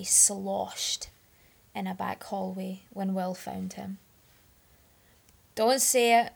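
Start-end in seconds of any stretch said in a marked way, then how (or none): 5.5-6.3 put-on loud drunken warning. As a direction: warn him off, loud. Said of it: "Merlin warned".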